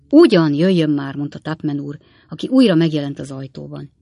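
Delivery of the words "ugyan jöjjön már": louder, urging call; raised, impatient voice; impatient summons. A narrator's reading. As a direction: hurry someone along from the doorway.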